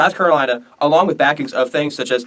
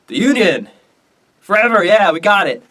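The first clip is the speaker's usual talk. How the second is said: raised, high victory cheer; acted triumph; 7 semitones higher, 5 dB louder than usual. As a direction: proclaim it like a rallying cheer. Jackson celebrating the Union, acted.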